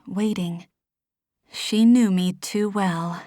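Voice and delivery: usual speaking voice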